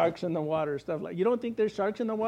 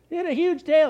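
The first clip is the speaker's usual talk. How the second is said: higher, louder, wide-eyed telling; excited retelling; 8 semitones higher, 5 dB louder than usual. Retold or imitated. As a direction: still thrilled by the gator.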